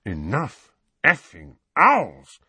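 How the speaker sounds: slow, irritated grumble; distracted mutter. A narrator's reading. Uncle fed up with owls at the window, distracted mutter. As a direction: grumble it slowly, mind elsewhere. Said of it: "muttered Uncle Vernon distractedly".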